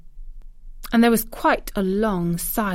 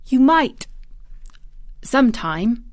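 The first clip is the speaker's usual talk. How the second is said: bold; 5 semitones higher than usual. A narrator's reading. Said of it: "she said something bold".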